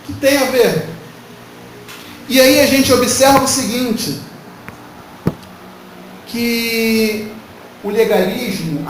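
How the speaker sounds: normal talking voice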